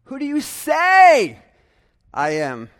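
demonstrating emphasis on "say"; stress on SAY. Shown as a demonstration. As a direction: punch "say" in "Who do you say I am". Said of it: "As if, do you say it at all".

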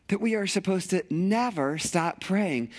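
ordinary talk, nothing marked

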